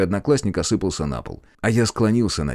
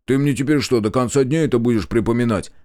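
ordinary delivery, nothing marked

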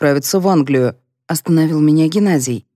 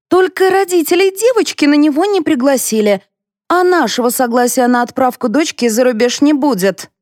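indignant objection; 9 semitones higher than usual. A narrator's reading. Mother objects in family quarrel.

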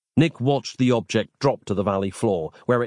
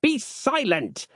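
commanding; slower than usual. A narrator's reading. Harland silences Nick.

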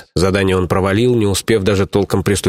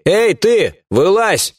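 окрик; 11 semitones higher, much slower than usual. Acted. Бандит окликает спрятавшегося.

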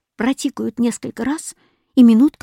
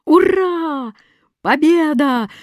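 high, exultant cheer; joyful; 7 semitones higher, 4 dB louder, slower than usual. A narrator's reading. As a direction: cheer the victory with soaring pitch. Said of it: "радостно прыгал восклицательный".

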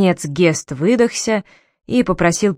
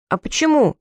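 put-on child asking; 7 semitones higher than usual. A narrator's reading. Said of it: "спросил Гейр".

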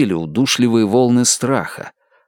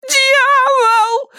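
high, piercing shriek; 3 dB louder than usual. Acted. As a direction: shriek it in terror. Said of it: "завизжала она".

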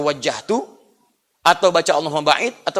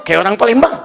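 put-on a touch of Palembang accent, louder; 3 semitones higher, 5 dB louder than usual. Preacher joking about regional accents, Palembang accent. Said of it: "Bicara bahasa Palembang".

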